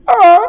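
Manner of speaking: high, loud yelp of panic; panicked scream. Retold or imitated. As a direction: shriek in horror. Dad's scream.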